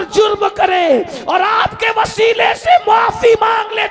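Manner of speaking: high, loud rejoicing proclamation; jubilant announcement. Acted. Gabriel to the Prophet, jubilant loud proclamation. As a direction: shout the good news in joy.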